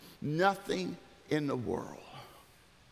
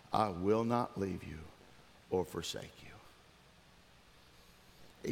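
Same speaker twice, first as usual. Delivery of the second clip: solemn scripture reading; 7 semitones lower, 4 dB quieter than usual. Real, not acted. Pastor reading Joshua to the church, solemn and hushed. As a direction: let the promise settle, falling away softly.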